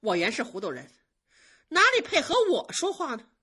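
offended maid sulking sarcastically; sulky scoff. Acted. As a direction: toss the head and snap back. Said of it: "晴雯一扭头".

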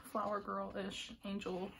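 normal talking voice

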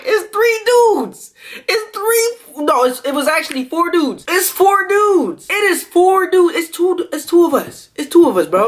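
high, slow, disbelieving count of dudes; mock panic. Comic realizes they are outnumbered, high mock panic. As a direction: count the odds in hammed-up alarm.

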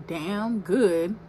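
normal talking voice

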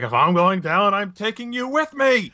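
high, theatrical villain's last threat; hammed-up doomed villain, pitch climbing; Andross. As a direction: imitate a game boss going down. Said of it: "that Andros from Star Fox moment".

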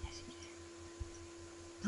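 slow, drawn-out whisper. Acted. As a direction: whisper it slowly and teasingly. Teasing about meth, drawn out.

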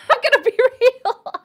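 high, loud, through laughter; laughing. Real, not acted. Streamer confessing German sounds slutty, cracking up. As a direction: barely get it out for laughing.

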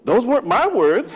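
loud, high-pitched self-defense; hammed-up indignant parental protest. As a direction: plead your innocence at full volume. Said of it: "I did what most parents would do and say".

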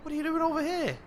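very high, loud incredulous cry; exasperated disbelief. Pretend. Player to a Sim found in the wrong spot, exasperated disbelief. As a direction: squawk the question in high-pitched disbelief.

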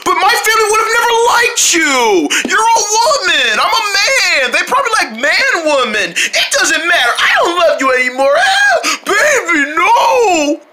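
high, tearful shouting lover; high-pitched sobbing girlfriend breaking up; crying jilted woman. Acted. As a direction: wail it out like a heartbroken soap heroine.

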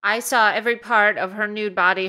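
normal talking voice

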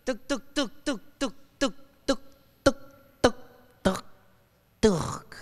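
sputtering "tuk" noises, gradually spacing out; imitated dying car engine. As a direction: mimic an engine stuttering to a stop. Imitates his car dying on freeway.

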